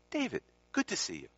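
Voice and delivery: high, lilting imitated greeting; retold Swami's greeting. Swami greeting the student at the Hermitage, high lilting hello. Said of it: "Same inflection every time".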